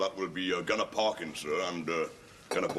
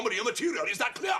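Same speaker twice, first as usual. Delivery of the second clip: shouting. Acted. Sergeant major shouting.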